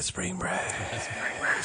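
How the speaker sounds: whispered spring break; ghostly hushed whisper of "Spring break". Shown as a demonstration. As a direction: breathe "Spring break" unvoiced, no tone. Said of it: "whispered repeat of catchphrase".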